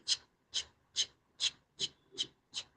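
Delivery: tiny whispered ticking sounds; very soft, voiceless rhythmic chicks; chick steps. Acted. Storyteller sounds out the chick walking, tiny whispered clicks.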